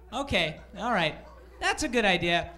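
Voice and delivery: ordinary talk, nothing marked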